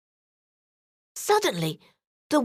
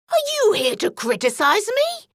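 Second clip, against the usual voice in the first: higher, irritated granny voice; wolf getting angrier, higher; annoyed fake granny; 9 semitones higher than usual. A narrator's reading. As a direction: bristle like a grumpy granny. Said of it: "getting angrier".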